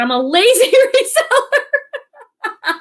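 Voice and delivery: slow, laughing through the words; reading a viewer's comment with a laugh. Real, not acted. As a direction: chuckle while reading the joke out. Reads a viewer's joke.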